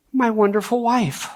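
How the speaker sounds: ordinary delivery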